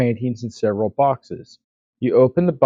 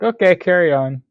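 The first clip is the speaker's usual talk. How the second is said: higher, louder breezy brush-off; mock casual housemate; 6 semitones higher, 5 dB louder than usual. Player voicing Catalina shrugging off the intruder, breezy. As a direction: toss it off like you don't care at all. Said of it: "She's like".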